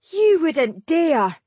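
put-on high, slow, wailing outrage like a child; childlike howl. A narrator's reading. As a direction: howl it like an indignant boy. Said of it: "a childlike howl of indignation".

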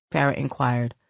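ordinary delivery, nothing marked

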